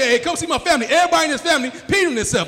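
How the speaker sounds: high, loud, fast mock summons; acted calling out. Man summoning his family to look, calling out. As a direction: call everyone over excitedly.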